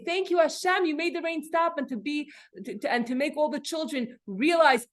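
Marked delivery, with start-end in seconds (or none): 0.0-1.8 higher, joyful mock thanks; grateful exclamation. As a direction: sound thrilled and thankful. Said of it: "to rise above it and say".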